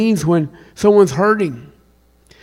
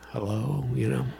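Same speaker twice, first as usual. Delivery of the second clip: wry whispered aside; 5 semitones lower, 16 dB quieter than usual. Real, not acted. Wry aside after a silly question.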